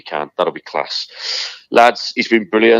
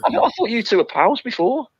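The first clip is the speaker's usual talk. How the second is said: high, disbelieving; surprised. Real, not acted. Telling his mate he thought they were pals, surprised. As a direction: voice leaps up, can't believe it. Surprised friends never met.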